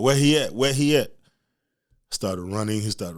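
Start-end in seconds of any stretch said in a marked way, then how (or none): 0.0-1.1 louder, higher demand; angry dad. Retold or imitated. Dad coming home hunting for his son, loud. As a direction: bark for the kid. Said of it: "talking about".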